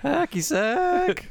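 put-on high, very slow old man voice. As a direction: drag it out like an ancient grandpa. Quoting grandpa's line from clip.

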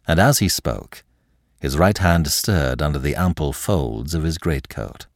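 ordinary delivery

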